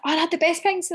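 high, gushing recollection to a friend; high-pitched gush to a pregnant friend; gushing quote. Retold or imitated. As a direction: gush it up high like it was all bliss. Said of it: "and then I was like".

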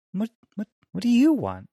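high, tender, concerned questioning; concerned, affectionate companion. Acted. Voicing Jesus as a caring friend, high and concerned. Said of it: "concerned, affectionate companion".

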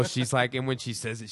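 ordinary talk

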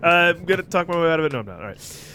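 ordinary talk, nothing marked

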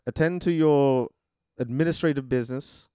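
formal English butler, measured pauses between duties; British butler. Acted. Butler listing duties for his master, British and emphatic. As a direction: list it off in a British accent. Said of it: "he's an English robot butler".

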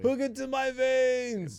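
high, loud mocking voice; teasing a friend about taking a huge dose; mock greedy user. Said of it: "being like".

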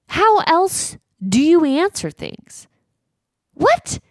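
high-pitched baffled questioning; incredulous. Real, not acted. Reacting to his answering in words, incredulous. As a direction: pitch it way up in disbelief.

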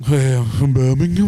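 slow, drawn-out Brummie; Brummie accent. Acted. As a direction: stretch it out in mock Birmingham.

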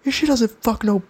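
mock the giant husband's outrage, pitch way up; imitating an angry guy. Huge husband confronting the podcaster, hammed-up high mock anger.

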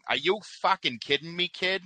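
put-on high-pitched Boston accent rant. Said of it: "with a Boston accent".

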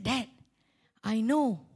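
normal talking voice

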